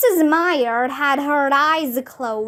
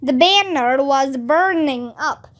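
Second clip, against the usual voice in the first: ordinary delivery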